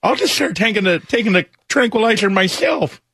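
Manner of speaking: high, put-on sulky boy; Tyler. Co-host as miserable stepson giving up, high mock sulk. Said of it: "this is exactly how he talks".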